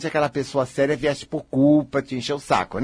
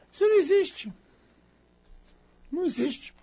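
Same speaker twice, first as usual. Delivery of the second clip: mock denial; 12 semitones higher, 5 dB quieter than usual. Mocking repeat of other's denial.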